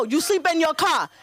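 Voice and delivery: ordinary talk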